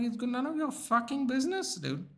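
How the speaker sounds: high, sharp telling-off with a swear; defiant retort. Retold or imitated. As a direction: snap back that it's none of their business. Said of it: "I'm like".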